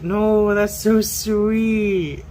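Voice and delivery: high, slow, loud delighted gush. Retold or imitated. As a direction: stretch out a delighted squeal. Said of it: "I remember just being like".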